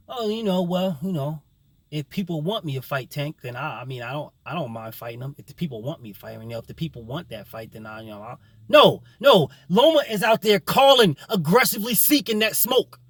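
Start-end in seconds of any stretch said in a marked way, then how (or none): 0.1-8.4 mock hedging mumble, much softer and fading away; mumbling evasive mockery. Mocking a fighter who dodges fights, hedging softly. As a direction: shrink into a timid, noncommittal murmur. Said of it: "He's not saying".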